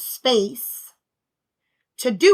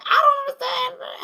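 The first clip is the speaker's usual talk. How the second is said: tearful blow-up. Retold or imitated. Mother re-enacting her hallway breakdown, tearful wail. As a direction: cry it out, overwhelmed. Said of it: "tears are flowing".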